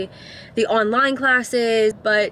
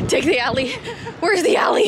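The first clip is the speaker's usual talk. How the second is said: breathless giggly running talk; laughing and out of breath; laughing while running; 5 semitones higher than usual. Real, not acted.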